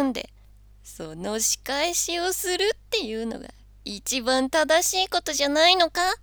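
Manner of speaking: loud high scheming devil. Acted. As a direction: goad him, sly and loud. Devil tempting Santa.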